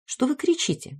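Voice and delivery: put-on a small girl complaining lightly; displeased child. A narrator's reading. Little daughter interrupting the adults, displeased. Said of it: "недовольно спросила".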